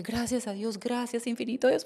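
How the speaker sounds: soft, relieved thanks to God. Retold or imitated. La niña agradece aliviada. (la niña agradece a Dios, aliviada y muy suave)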